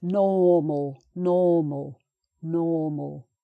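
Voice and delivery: "normal" as "naw-mal", long first vowel; carefully stretched "normal", no R sound. Shown as a demonstration. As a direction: model British "normal" with the R silent. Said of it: "And then this one similar".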